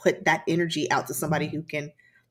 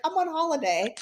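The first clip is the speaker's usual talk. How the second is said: put-on British accent; 9 semitones higher than usual. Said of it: "I just had this British accent".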